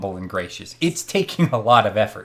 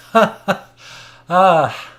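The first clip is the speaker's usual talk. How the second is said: higher, louder haughty mock laugh; a scornful laugh; 9 semitones higher, 8 dB louder, much slower than usual. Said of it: "And Strahd laughs".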